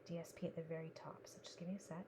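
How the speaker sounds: quiet working whisper. Real, not acted. Crafter whispering to herself over the card. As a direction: murmur it under your breath.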